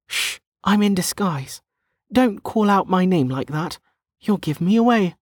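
put-on high-pitched young woman in disguise; hushing protest. A narrator's reading. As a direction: shush her in a high character voice. Hushing her for calling out name.

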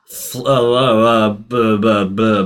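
stuck on a word, stammering bu-; tongue-tied, stammering. Real, not acted. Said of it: "Are you all right?".

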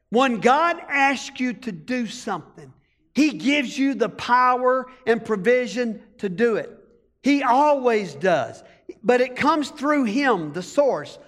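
usual speaking voice

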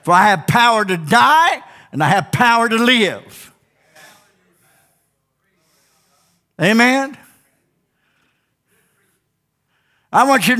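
high-pitched, emphatic preacher voice; fervent preaching. Real, not acted. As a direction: drive it home to the congregation, pitched high. Preaches with raised voice.

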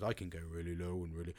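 deep, low demo of range; really low voice. Shown as a demonstration. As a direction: drop the pitch right down to demonstrate low. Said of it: "Men have really big pitch changes".

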